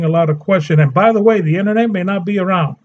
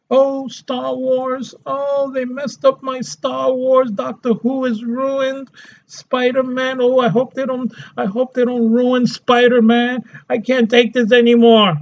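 mock whiny fan; 5 semitones higher than usual. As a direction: moan like a heartbroken fan. Mocking fans whining about franchises.

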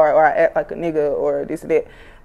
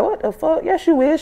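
imitating mom; 10 semitones higher than usual. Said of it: "She was like".

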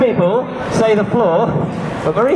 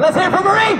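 big rising showman call, much higher; crowd hype; 12 semitones higher than usual. Acted. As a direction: whip the crowd up for Marie. Hyping the crowd for volunteer.

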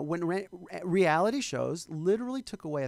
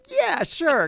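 much higher and louder, overeager fake confidence; bluffing yes; 13 semitones higher, 11 dB louder than usual. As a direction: fake total certainty to keep the job. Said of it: "I was like".

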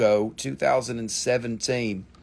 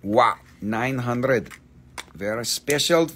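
normal talking voice

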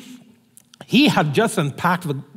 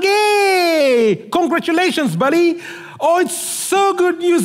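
excited greeting; 8 semitones higher than usual. Acted. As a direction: burst out with joy at your buddy. Acted excited greeting of friend.

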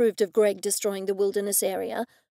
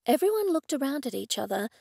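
ordinary delivery

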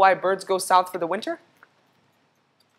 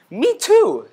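excited; 10 semitones higher, 7 dB louder than usual. Acted. Moose answers Squirrel eagerly.

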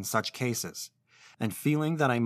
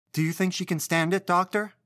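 higher, worried pleading mother; anxious mother; 7 semitones higher than usual. Acted. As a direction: ask the doctor in anguish. Anxious mother asks doctor.

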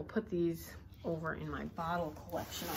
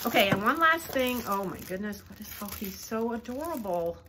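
usual speaking voice

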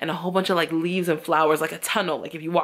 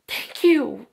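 touched thank you; 7 semitones higher than usual. Retold or imitated. Touched by stranger's kindness.

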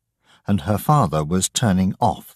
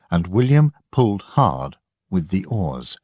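ordinary delivery, nothing marked

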